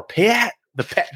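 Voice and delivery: a slip into a half Boston accent on Pat. Real, not acted. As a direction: Pat with a hint of Boston vowels. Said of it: "that was like a half Boston accent".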